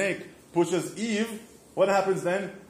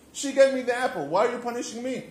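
indignant blaming; 8 semitones higher, 3 dB louder, faster than usual. Retold or imitated. Adam blaming, imagined complaint.

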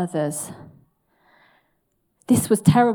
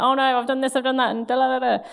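mock fretting; 6 semitones higher, faster than usual. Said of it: "And I'm like".

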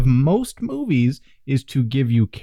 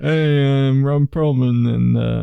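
Ron Perlman impression. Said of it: "Do your best Ron Perlman impression".

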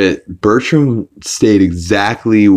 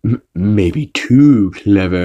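slow, deliberate cartoon character voice; slow, scheming impression; imitating Stewie; slower than usual. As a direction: weigh it up suspiciously in a put-on voice. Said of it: "he's like".

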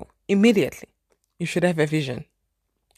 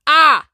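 shocked exclaim; 8 semitones higher, 10 dB louder than usual. Retold or imitated. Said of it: "startled exclamation".

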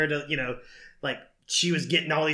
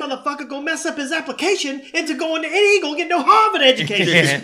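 high, fast comic rant; Richard Pryor; 11 semitones higher, 6 dB louder, faster than usual. Acted. Kids raised on stand-up, loud profane mock rant. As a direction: rattle it off, building louder. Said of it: "they got raised on, like, what was, uh, Richard Pryor".

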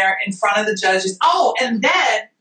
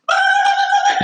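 ecstatic cheer. Retold or imitated. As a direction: scream out the win. Celebrating again.

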